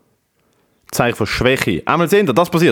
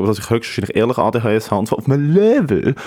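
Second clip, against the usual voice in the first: normal talking voice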